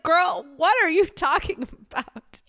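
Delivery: sassy what-are-you-on-about; incredulous. Retold or imitated. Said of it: "And I'm like".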